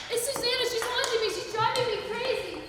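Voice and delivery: high, loud panicked cries; on the edge of breaking; hysterical fear. Acted. Fran hysterical about haunting.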